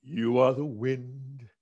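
put-on slow, heartfelt address to his kids; slow, tender, half-sung tribute. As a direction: speak it like a song lyric to loved ones. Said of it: "I'd probably say".

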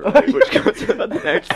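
high laughing words. Real, not acted. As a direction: laugh through the line. Joke about vegan bongo hour.

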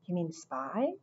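hushed, eager question. A narrator's reading. Henry keen to go spying on the rival, eagerly hushed. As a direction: ask eagerly in a soft, secretive voice. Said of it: "said Henry eagerly".

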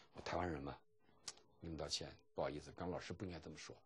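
soft, low voice of a consoling classmate; imitated hushed apology. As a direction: apologise quietly on someone else's behalf. Acting classmate's gentle consoling apology. (模仿同学低声安慰致歉)

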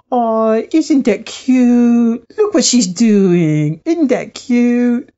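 imitating a little spirit, slow, drawn-out coo. Little spirit voice cooing over someone setting up a ritual, drawn-out mock coo. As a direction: draw it out like cooing over something cute. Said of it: "this little voice giggling, going".

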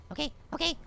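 low, very soft self-check; hushed relief. Real, not acted. Player thinking he lost the monster, hushed. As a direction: murmur it to settle yourself. Steadying himself after escape.

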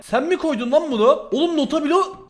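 startled outburst. Real, not acted. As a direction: blurt it out, caught off guard. Startled by unknown item in chest. (şaşkın ve yüksek sesle)

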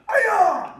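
the right kind of fighting yell; strong kiai. Shown as a demonstration. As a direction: belt it out from the belly. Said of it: "make sure it's that yell".

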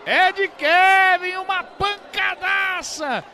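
long, stretched, high goal yell; goal scream. Real, not acted. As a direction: explode on the shot and stretch it. Goal call. (grito de gol explosivo)

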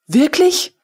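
a high, astonished question; surprised woman asking back. A narrator's reading. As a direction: ask it in disbelief, lifted high. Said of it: "Die andere erstaunt".

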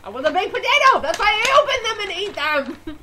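loud, high burst of protest; excited outburst. Real, not acted. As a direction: blurt it out, worked up. Defends eating the whole bag.

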